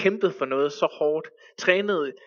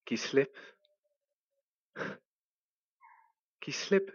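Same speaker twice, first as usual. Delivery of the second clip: soft, calm voice answering; a hushed voice from beyond; quiet voice; 3 semitones lower, 10 dB quieter than usual. Acted. An answering voice tells the climber to let go, soft and calm.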